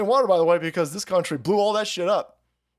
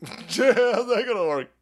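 higher, drawn out through laughter; laughing incredulously; 5 semitones higher, slower than usual. Real, not acted. Asking how the conversion would work, laughing incredulously. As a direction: crack up while asking it. Cracks up at his own bit.

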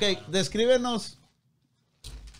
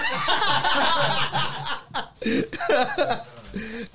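pained laugh; 7 semitones higher than usual. Real, not acted. Hot sauce just tasted.

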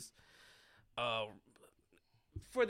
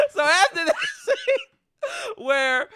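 slow, laughing, higher words; laughing through words; 13 semitones higher, 9 dB louder, slower than usual. Real, not acted. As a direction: try to talk through real laughter. Laughing while retelling the scene.

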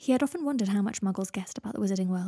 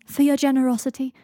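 loud, higher-pitched show of thanks; 5 semitones higher, 8 dB louder than usual. A narrator's reading. Seven thanking Draco for money in the street, loudly. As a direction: call it out for all to hear. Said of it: "said Seven loudly".

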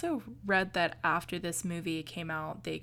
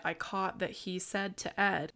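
ordinary talk

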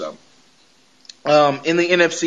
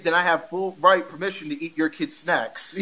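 ordinary talk

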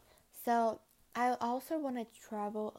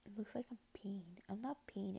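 soft breathy ASMR whisper; 11 dB quieter than usual. Pretend. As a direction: whisper it close to the mic. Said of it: "Guys, look, A S M R".

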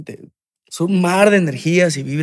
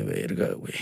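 breathless unvoiced whisper of amazement; whispered awe; 10 dB quieter than usual. Rapper retelling his stunned reaction, whispering. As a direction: hush the words out in disbelief.

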